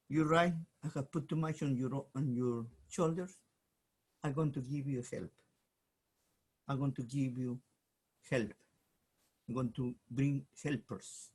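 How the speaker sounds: low, soft, calm voice of God; gentle reassurance. Acted. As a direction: comfort him kindly and quietly.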